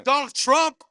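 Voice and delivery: high, loud shout-out; rowdy high-pitched crowd cheer; excited cheer. Real, not acted. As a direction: holler it out to the room. Audience member cheers for Americans.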